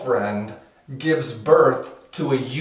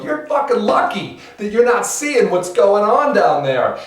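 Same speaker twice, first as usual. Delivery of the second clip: high, insistent complaint; villagers' souls; 9 semitones higher, 5 dB louder than usual. Acted. Dead villagers hover over the blind man, high and aggrieved. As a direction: call it down in a high voice. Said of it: "disembodied souls of the villagers".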